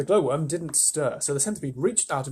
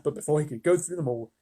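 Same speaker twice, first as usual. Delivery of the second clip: ordinary delivery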